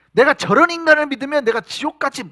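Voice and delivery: high, loud furious denial; cursing Peter. Acted. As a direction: curse him out to save yourself. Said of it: "베드로가 예수를 저주하며". (예수를 저주하는 베드로의 높고 큰 분노)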